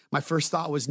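ordinary talk, nothing marked

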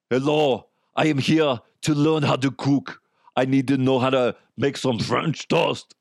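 put-on Austrian-accented action-star voice; Arnold Schwarzenegger. Arnold learning to cook, heavy Austrian accent. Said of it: "Arnold DeHuff or Schwarzenegger DeHuff".

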